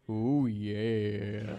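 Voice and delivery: low, drawn-out mock crooning; [name]. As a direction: tease a co-host with a low, long ooh. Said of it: "[name]'s getting all".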